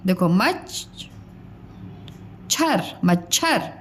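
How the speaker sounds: मच and छर split apart slowly, then मच्छर joined; slow classroom split of मच्छर; split syllable demo. Shown as a demonstration. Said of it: "च की sound कम आ रही है".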